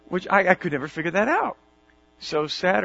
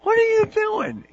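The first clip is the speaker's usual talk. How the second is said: sharp high confrontational challenge, louder; confronting neighbour; 6 dB louder than usual. Retold or imitated. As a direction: snap it out like an angry neighbour. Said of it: "somebody walks up to you and says".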